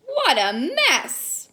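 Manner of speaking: plain reading voice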